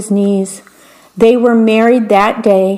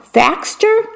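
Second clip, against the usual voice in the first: queen guessing; 12 semitones higher than usual. Acted.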